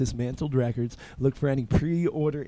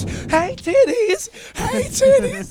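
high, loud taunting yell; mock scream; 7 dB louder, slower than usual. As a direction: scream it in her face like a pest. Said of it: "Zach's screaming in my face".

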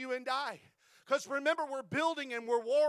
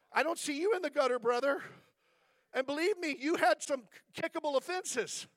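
retold rebuke; 3 semitones higher than usual. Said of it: "I say".

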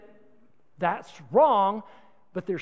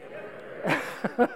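breathy laugh; 3 dB quieter than usual. Real, not acted. Laughs after the shout.

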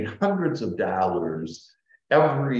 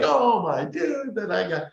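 mock complaining; 12 semitones higher than usual. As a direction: ham up a grumbling person, pitched high. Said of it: "most people would be like".

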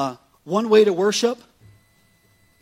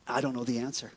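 much softer, almost under the breath; quiet admission; 8 semitones lower, 12 dB quieter, faster than usual. Real, not acted. Pastor admitting to Steve, softly. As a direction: confess it quietly to yourself. Answers own question softly.

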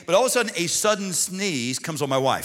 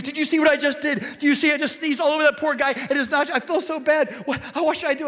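higher, hurried voice of his fretting wife; panicked wife; 9 semitones higher than usual. Retold or imitated. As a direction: gush it out like she's mortified. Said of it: "She goes".

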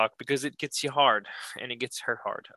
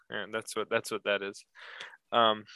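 ordinary talk, nothing marked

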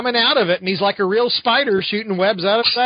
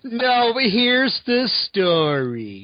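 slow, whiny mock complaint; 3 semitones higher, much slower than usual. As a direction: whine it out slowly like a kid. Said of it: "don't do it in a whiny voice".

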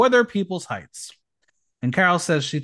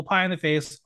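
ordinary delivery

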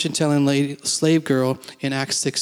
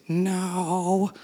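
higher, softer, drawn-out demon voice; imitated demon; 4 semitones higher, 4 dB quieter than usual. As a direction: imitate a demon's long, soft refusal. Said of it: "like that".

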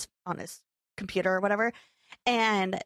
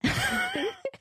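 high squealing laugh. Real, not acted. Woman laughing at her own mishap, shrieking.

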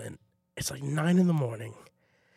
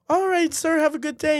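much higher, lightly voiced sweet goodbye; polite kids; 10 semitones higher, 5 dB louder than usual. Students wishing the assistant a good day, high sweet. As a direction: chirp a polite farewell.

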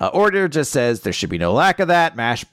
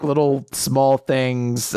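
usual speaking voice

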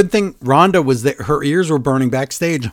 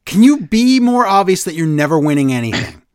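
normal talking voice

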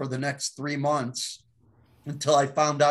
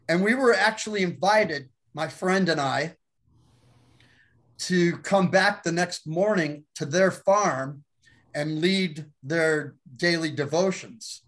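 normal talking voice

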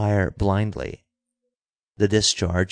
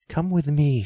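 higher-pitched, restrained female character cry; urgent cry; 7 semitones higher than usual. A narrator's reading. Girl urging Dick to come with her, mildly crying out. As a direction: plead lightly, pitch lifted. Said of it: "she cried".

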